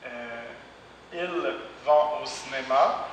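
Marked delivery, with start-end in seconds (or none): none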